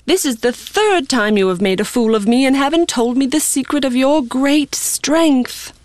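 high-pitched, wheedling reproach; nagging. Acted. Delilah nags Samson to confide his secret, high-pitched nagging. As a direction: nag him in a high voice, drawing out the end. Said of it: "With such nagging, she prodded him".

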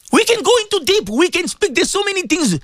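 high-pitched, urgent sermon delivery; impassioned preaching. Acted. Preaches at full force.

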